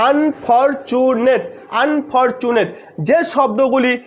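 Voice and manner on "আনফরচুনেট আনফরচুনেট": "Unfortunate" slowly counted out in four beats; syllable by syllable. Shown as a demonstration. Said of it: "কি কি".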